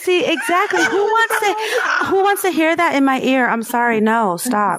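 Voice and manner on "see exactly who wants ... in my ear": laughing protest. Real, not acted.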